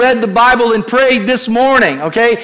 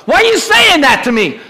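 a higher, louder defensive snap; mock angry retort; 8 semitones higher, 3 dB louder than usual. Spouse pushing back in an argument, mock angry. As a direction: throw it back at your spouse, stung. Acted quarrel between spouses.

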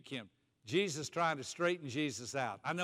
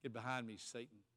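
lower, much softer, almost under the breath; quiet rebuke; 5 semitones lower, 12 dB quieter than usual. Acted. Jesus rebuking Peter again, low and hushed. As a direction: say it low and grave, close in. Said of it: "And Jesus said".

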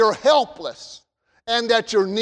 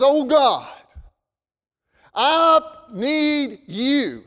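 humble king's plea; 3 semitones higher, much slower than usual. Retold or imitated. Preacher voicing King Solomon's prayer as a humble plea. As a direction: plead with God, drawing out the need. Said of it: "he said it like this".

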